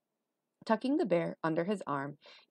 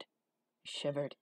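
ordinary delivery

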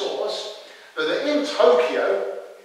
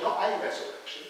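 ordinary talk

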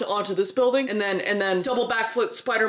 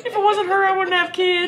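imitated Texan; 10 semitones higher, 3 dB louder than usual. Echoing the Texan, touched.

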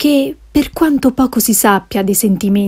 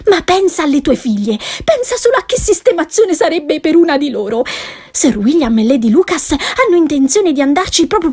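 higher, pressing society wife; higher urgent pleading wife; insistent wife; 6 semitones higher than usual. Acted. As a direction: push him to go.

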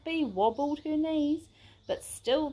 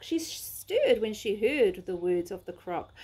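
usual speaking voice

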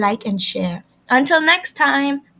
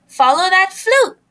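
higher, louder chase call; yelled; 7 semitones higher, 6 dB louder than usual. A narrator's reading. The friends yelling after the flute, loud and higher. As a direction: shout for the flute. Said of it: "yelled Fifi, Frog, Fawn, and Fox".

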